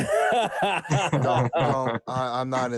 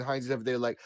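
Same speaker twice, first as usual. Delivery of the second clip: ordinary delivery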